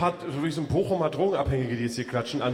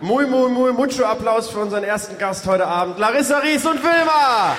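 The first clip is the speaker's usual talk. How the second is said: high, loud showman announcer; grand entrance announcement; 7 semitones higher, 7 dB louder than usual. Acted. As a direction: announce the guest like a gala host. Kündigt Gast auf Bühne an. (große Ansage wie ein Showmaster)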